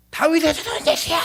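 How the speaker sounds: strained, high scream to be heard; shouted cry. Acted. Blind Bartimaeus crying out to Jesus, screaming shout. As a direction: cry out desperately at a high pitch. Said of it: "이게 어떻게 소리 지르냐면요".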